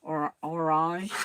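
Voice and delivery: "orange" drawn out slowly in a strange accent; odd accent on orange. Real, not acted. Sewing host hears her own odd accent, saying "orange" slowly. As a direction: stretch out "orange", unsure how it comes out. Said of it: "I don't know what accent that's coming out of".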